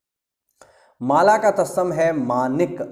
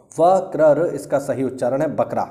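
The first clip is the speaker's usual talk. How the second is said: ordinary talk, nothing marked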